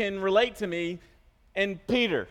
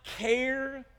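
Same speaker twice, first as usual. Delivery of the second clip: drawn-out, higher-pitched plea; pleading disciples; 5 semitones higher, much slower than usual. Acted. Disciples waking Jesus in the storm, drawn-out plea. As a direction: stretch each word in appeal. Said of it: "they woke up Jesus saying".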